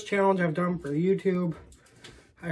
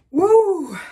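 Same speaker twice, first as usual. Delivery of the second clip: excited whoop; 12 semitones higher, 8 dB louder than usual. Real, not acted. Whooping at the heat rush.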